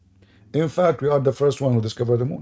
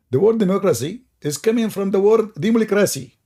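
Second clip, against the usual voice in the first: higher, louder mock Libyan accent; Libyan accent imitation; 8 semitones higher, 3 dB louder than usual. Quoting Gaddafi's speech in a mock Libyan accent. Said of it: "In his, uh, local accent".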